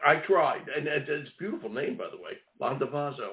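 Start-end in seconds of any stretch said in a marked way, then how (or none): 2.6-3.3 "Landavazo" opening with 'lon', softly; corrected pronunciation. Real, not acted. Host admiring guest's surname, softly said "Landavazo" starting like 'lon'. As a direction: say "Landavazo" with an open 'ah' in the first syllable. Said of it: "It's Lon".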